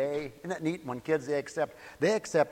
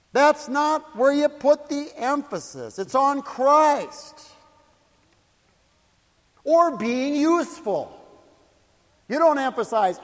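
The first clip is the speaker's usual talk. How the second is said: full-force preaching; 6 semitones higher, 8 dB louder than usual. Real, not acted. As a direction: hammer each phrase out with long pauses.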